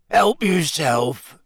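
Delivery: slow, teary Hagrid voice; choked up and slow; tearful giant. A narrator's reading.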